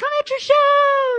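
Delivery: very high, loud, slow squeal of a thrilled mom; thrilled mom squealing at her kid's show; happy mom. Retold or imitated. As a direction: gush it like a proud mother. Mom happily arrives at show.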